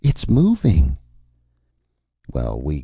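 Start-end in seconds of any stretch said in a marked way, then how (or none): 0.0-1.0 high, loud panicked exclamation; screamed alarm. A narrator's reading. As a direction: shriek the warning. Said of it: "one of the clerk's typists screamed".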